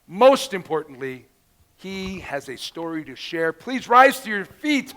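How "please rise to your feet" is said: loud rising hype call to the stage; excited announcer shout. Real, not acted. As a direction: call the guest out like a ring announcer. Announcer calls speaker onstage.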